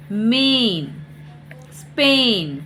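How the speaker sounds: मेन, स्पेन each stretched on "ay"; long A sound. Shown as a demonstration. Teacher giving AI examples, मेन, स्पेन with held long "ay". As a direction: draw out the vowel in मेन and स्पेन. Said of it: "ए पढ़ते हैं".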